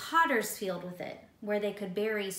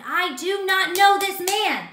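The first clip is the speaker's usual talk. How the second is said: much louder, much higher, slow and hard; angry denial; 9 semitones higher, 12 dB louder than usual. Acted. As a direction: spit out the denial in anger. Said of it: "started cursing and getting angry".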